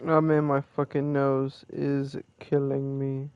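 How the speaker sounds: ordinary talk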